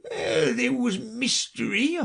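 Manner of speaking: slow, high, doleful creature; Mock Turtle. A narrator's reading. Mock Turtle recalls his subjects, slow doleful voice. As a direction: recall it haltingly in a high wail. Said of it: "the Mock Turtle replied".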